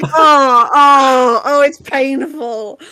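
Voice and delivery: mock anguish. Groaning at the cheesy name.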